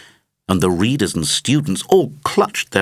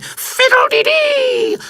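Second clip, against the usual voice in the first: much higher, louder and slow, a happy parrot's squawk; shrill, piercing cry; 4 dB louder, slower than usual. Acted. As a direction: let it ring out in a piercing bird cry. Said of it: "in a shrill, piercing tone".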